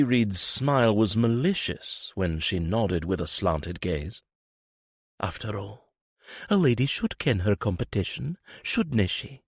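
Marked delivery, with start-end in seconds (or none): 5.2-9.4 sly Lady Reed. Acted.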